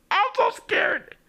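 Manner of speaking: high, slow frightened whimper; scared. Acted. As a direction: whimper it out terrified. Character terrified by Yuri.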